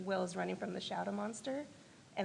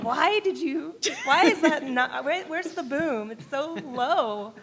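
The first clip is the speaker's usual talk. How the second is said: high, loud mock-exasperated protest; exasperated complaint; 6 semitones higher, 9 dB louder, slower than usual. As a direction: complain in playful disbelief, voice raised high. Mock complaint to sound mixers.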